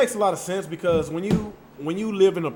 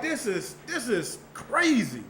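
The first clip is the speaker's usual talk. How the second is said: retold disbelief; 8 semitones higher, slower than usual. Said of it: "I'm like".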